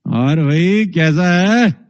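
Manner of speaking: slow, threatening greeting; menacing tone. Shown as a demonstration. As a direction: make a friendly hello sound dangerous. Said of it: "अब ये tone देखो कितनी खतरनाक है". (धीमा, धमकी भरा लहजा)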